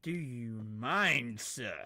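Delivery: loud, slow, drawn-out reprimand; indignant rebuke. Acted. Player rebukes the pig, loud mock indignation. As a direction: lean hard and slowly on the affront.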